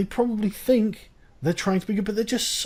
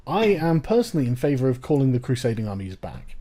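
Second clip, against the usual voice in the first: normal talking voice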